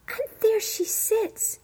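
high, slow and delighted; delighted pointing out. Acted. Kitchen girl shows the court the nightingale, delighted. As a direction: show off the find.

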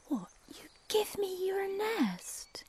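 high, slow, amazed question; can't believe the offer; amazed cuckoo. Acted. Cuckoo amazed that woodpecker gives her the nest. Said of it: "cuckoo astonished".

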